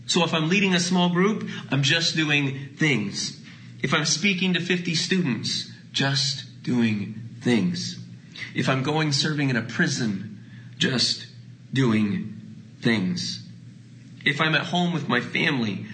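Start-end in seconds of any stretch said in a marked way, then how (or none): 1.7-3.4 a gentle, slow refrain. Acted. Slowing refrain. 5.9-8.0 very slow and lower; a very slow, lowered refrain. Acted. Slowing refrain. 10.8-13.4 lower, each word set apart by pauses; a slow, spaced refrain. Acted.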